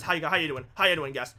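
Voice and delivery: ordinary talk, nothing marked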